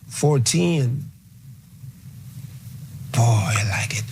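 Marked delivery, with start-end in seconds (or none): 3.1-4.1 breathy whispered aside. Real, not acted. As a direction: drop to a whisper, delighted.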